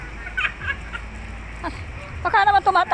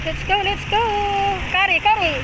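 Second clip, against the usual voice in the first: higher-pitched, eager cheering-on; urging a dog on; 4 semitones higher, faster than usual. Real, not acted. Owner urges Kari to get going, eager high calls. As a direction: coax the dog along in a raised pitch.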